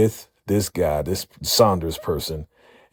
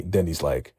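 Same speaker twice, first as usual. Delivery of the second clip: ordinary talk, nothing marked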